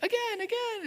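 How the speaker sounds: put-on squeaky excited child begging; eager toddler.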